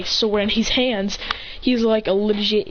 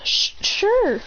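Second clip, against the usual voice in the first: stammered, higher, unsure yes; nervous stammer; 10 semitones higher than usual. Acted. Midoriya nervously accepting a spot on the team, stammering high. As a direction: trip over the first sound, shyly.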